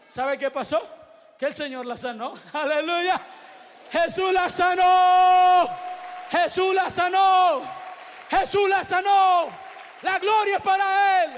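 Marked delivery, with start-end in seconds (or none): 2.5-11.3 high, loud, long-held final words; triumphant preaching shout. Real, not acted. Preacher proclaims the girl's healing, triumphant shout. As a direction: proclaim it slowly to the whole church.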